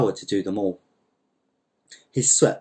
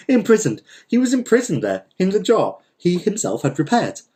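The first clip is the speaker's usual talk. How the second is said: high, incredulous exclamation; gleeful disbelief; 9 semitones higher than usual. A narrator's reading. Narrator relishing that the repairer trapped himself, gleeful disbelief. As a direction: marvel at it in a high, amused voice.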